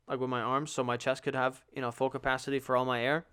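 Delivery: ordinary talk, nothing marked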